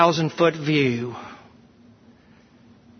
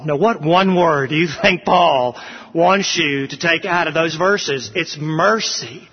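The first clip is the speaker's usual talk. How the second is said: ordinary talk, nothing marked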